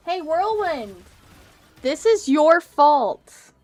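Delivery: loud furious shout, slowed down; angry yelling. Real, not acted. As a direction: yell the blame at him. Yelling at game character.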